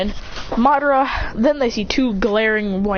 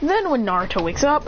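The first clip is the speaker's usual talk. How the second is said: usual speaking voice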